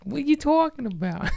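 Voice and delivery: squeaky high what are you talking about; high mock-innocent protest; innocent denial. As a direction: play dumb in a high squeak. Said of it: "And I was like".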